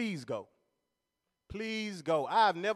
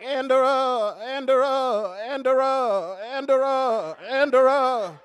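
loud, stuck repeating Andera like a stuttering preacher; mock the stammering pastor calling out Andera; 4 semitones higher, 6 dB louder, slower than usual. Comedian imitates stuttering pastor at baptism, loud stuck repeat. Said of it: "he stuttered".

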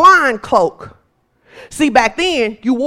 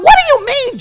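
higher-pitched disbelieving question; incredulous disciples; 11 semitones higher than usual. Acted. Disciples questioning Jesus, incredulous. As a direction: question him in lifted, puzzled disbelief.

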